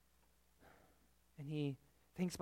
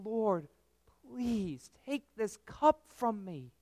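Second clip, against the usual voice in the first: very slow, higher, anguished plea; anguished prayer; 6 semitones higher, 3 dB louder, much slower than usual. Acted. Christ in the garden begging God, slow anguished plea. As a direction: draw each word out in agony. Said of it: "He wrestled with God in the garden".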